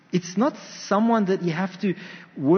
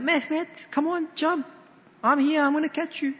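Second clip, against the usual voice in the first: higher, gentle call of a father urging his son; coaxing Turkish dad; 10 semitones higher than usual. Retold or imitated. Father calling to son in pool, higher coaxing. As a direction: coax a scared child to jump, higher voice.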